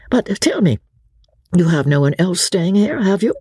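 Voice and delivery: inquiring lord. A narrator's reading. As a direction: ask it as a lord casually sounding out his host. Lord questions landlord.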